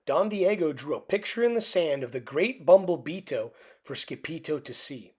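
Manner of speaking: ordinary delivery, nothing marked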